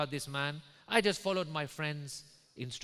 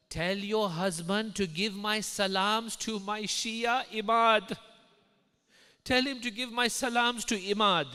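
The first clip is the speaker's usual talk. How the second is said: loud, high, slow and commanding; proclaiming Imam; 8 semitones higher, 9 dB louder than usual. Retold or imitated. Imam addresses the sick wife in a vision, proclaiming. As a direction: proclaim it in measured, ringing phrases. Voices Imam giving his message.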